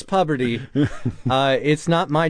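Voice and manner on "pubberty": "Puberty" insisted on as PUB-er-tee; short u in "Puberty", as in "pub"; joke mispronunciation. Shown as a demonstration. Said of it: "I'm pretty sure it's".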